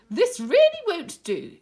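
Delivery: high, loud hen voice scolding; a fussy hen teacher calling the group to order; Miss Cluck, disapproving. Acted. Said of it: "she said".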